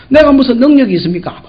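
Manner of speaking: usual speaking voice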